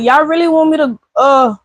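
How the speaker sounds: dog balking at the rain; voicing the dog. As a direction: speak for the dog, baffled. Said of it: "She looking at that rain like".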